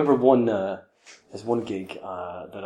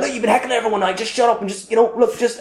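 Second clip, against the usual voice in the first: high, pleading retold rant; worked up, high but not shouted; manic telling-off; 8 semitones higher, faster than usual. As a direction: reenact a frantic telling-off, pitched high. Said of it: "but I was manic".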